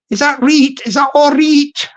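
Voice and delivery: mock Yorkshire dialect question; Yorkshire accent. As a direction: try out a Yorkshire "reet". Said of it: "that's how they talk in Yorkshire".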